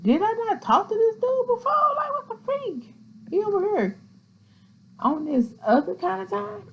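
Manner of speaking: high-pitched mock disbelief; acted-out incredulous questioning; baffled self-quote. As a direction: replay your puzzled reaction way up high. Acting out own baffled reaction.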